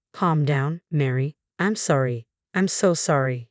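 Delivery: ordinary delivery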